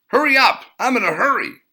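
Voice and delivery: high, loud, pushy demand; shrill impatient woman cutting the line; impatient rude woman. A narrator's reading. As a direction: shriek at him to hurry.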